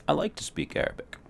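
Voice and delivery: high unvoiced whisper. Shown as a demonstration. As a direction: whisper it lightly. Mock dialogue, whispered.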